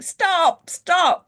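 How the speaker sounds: high urgent repeated stop; the cautious inner voice begging herself; inner voice pleading. Retold or imitated. Inner voice pleads with her to stop, high and urgent. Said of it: "this voice in my head saying".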